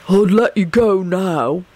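slow, put-on thug voice, mildly menacing; turning hostile. A narrator's reading. Bald man bargains with Sirius, slowly turning hostile. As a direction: let a threat creep in, slowly. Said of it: "his voice turning hostile".

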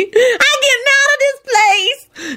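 very high, drawn-out panicked cry; hysterical scream. A narrator's reading. Shalonda fleeing the dead men, hysterical. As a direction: wail it in panic. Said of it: "Shalonda screamed hysterically".

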